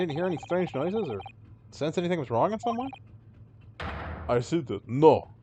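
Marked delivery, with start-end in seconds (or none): 4.3-5.3 louder Russian no; Russian-accented snap. Acted. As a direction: shut them down like a Russian guard. Sailor snaps at questions.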